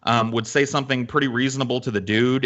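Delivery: usual speaking voice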